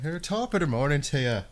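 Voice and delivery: lilting stage-Irish brogue; mock Irish accent. Shown as a demonstration. Streamer showing viewers his Irish impersonation, hammed-up Irish accent. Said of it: "pretty interesting impersonations of the Irish".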